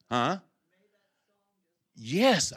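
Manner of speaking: normal talking voice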